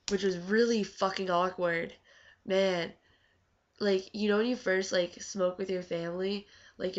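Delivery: usual speaking voice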